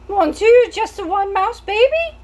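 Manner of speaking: put-on French accent, high and loud, dismayed; French mouse mother. French mouse mother aghast at one baby. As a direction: cry out in French-accented horror. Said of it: "She was a French mouse".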